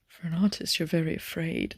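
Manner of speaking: soft, lightly put-on male character quote; imagined Tom. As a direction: lightly imitate his delivery, dropping the volume. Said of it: "That's how I imagine he would say it".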